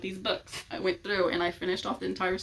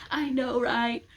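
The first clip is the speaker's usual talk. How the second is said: higher, louder eager fangirl agreement; excited agreement; 5 semitones higher, 4 dB louder, slower than usual. Pretend. Two fans freaking out together, excited. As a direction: gush along with a fellow fan. Imagined fan screaming together.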